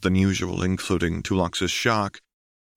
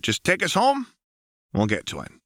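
higher, playful and teasing; lightly voiced Jinx; 12 semitones higher than usual. A narrator's reading. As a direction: tease him with a grin.

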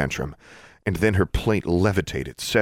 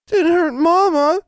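put-on high, slow, anxious child's question; worried toddler; 6 dB louder, slower than usual. A narrator's reading. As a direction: ask anxiously, arms held out. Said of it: "still sounding worried".

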